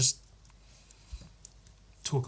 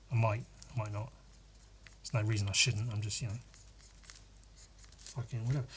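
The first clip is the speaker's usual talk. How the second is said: trailing mutter; 3 semitones lower, 11 dB quieter, much faster than usual. Real, not acted. Trailing off, self-dismissive.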